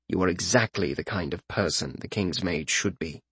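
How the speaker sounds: low, soft reassurance; calm him gently; reassuring. Acted. Reassuring the tearful mage.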